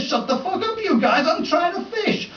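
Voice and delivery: high, fed-up and laughing; irritated yell. Retold or imitated. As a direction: tell the rowdy pair to pipe down. Angry angler yells at quarrelling men.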